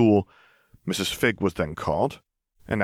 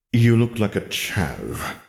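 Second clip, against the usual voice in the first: very slow, drawn-out sneer; disdainful verdict; much slower than usual. Acted. Wizard Lennon judging Lily's dyed hair, slow and disdainful. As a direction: let the insult drip out slowly.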